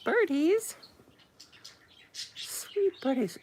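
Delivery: high cooing call to pets; high tender coo to the birdies; cooing to birds. Pretend.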